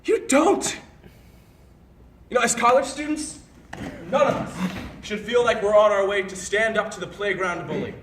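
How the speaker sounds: high, loud, escalating rant; angry tirade. Acted. Berating a former friend for flaunting false authority, angry. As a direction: berate them forcefully, peaking in shouted jabs.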